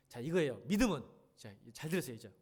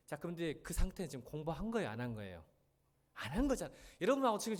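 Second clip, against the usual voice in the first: normal talking voice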